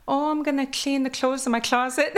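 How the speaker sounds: Kwakwala-accented English, amused. Shown as a demonstration. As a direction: Kwakwala accent, joking demo. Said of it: "So my accent".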